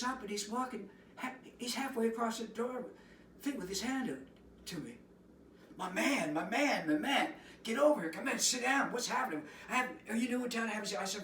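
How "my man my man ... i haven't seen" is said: loud, high, hearty welcome; effusive host greeting. Retold or imitated. Giuseppe calls him over, loud and high and hearty. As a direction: greet him like a long-lost pal.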